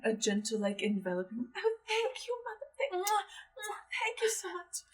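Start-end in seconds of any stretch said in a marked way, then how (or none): 1.5-4.8 tender thanks. Acted. Frail daughter thanks mother.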